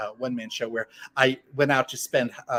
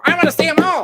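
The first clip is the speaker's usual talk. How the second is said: excited squeal; 14 semitones higher, 10 dB louder than usual. Real, not acted. Greedy Hollywood wishlist climax.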